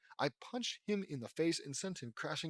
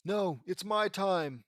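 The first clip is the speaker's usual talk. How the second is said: slow, high, loud villain cry; theatrical villain's final outburst; defiant last protest; 6 semitones higher, 8 dB louder, slower than usual. Acted. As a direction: cry out slowly in dramatic defiance. Villain's last protest while vanishing.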